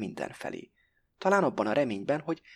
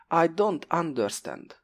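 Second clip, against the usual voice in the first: slow, careful schoolgirl English; slow textbook English from a Hungarian lady; schoolbook English; 7 semitones higher, slower than usual. A narrator's reading. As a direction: spell out each English word like a nervous pupil. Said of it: "felettébb iskolás angolsággal".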